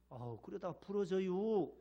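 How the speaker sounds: slow, unbothered Chungcheong warning; Chungcheong slow calm. Retold or imitated. Chungcheong man warning his arm will break, slow and unbothered. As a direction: stretch it out lazily in Chungcheong dialect. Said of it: "충청도 분들 팔을 삐틀어도".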